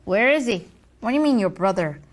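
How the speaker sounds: usual speaking voice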